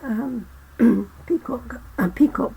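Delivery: voix fatiguée et enrouée; losing voice. Real, not acted. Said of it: "So I'm losing my voice".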